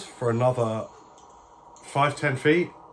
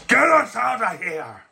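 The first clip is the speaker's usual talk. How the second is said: raised, forceful creature voice; high, urgent draconic cry; draconic prisoner; 9 semitones higher than usual. Acted. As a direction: demand release as a dragon-faced captive. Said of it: "draconic looking face. Just goes".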